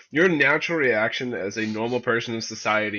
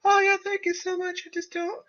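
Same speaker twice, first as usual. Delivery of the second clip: mock a sweet helpless girl; girly girl voice. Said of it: "they're gonna be like".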